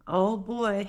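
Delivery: usual speaking voice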